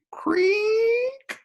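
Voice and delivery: very high, loud creaking cry; mock creak. Narrator voicing the cellar door's creak, high and loud. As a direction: squeal it like old hinges. Door creak sound effect.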